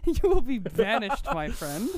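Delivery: hammed-up banishing verdict; mock royal banishment. Playfully banishing a friend like a king, slow.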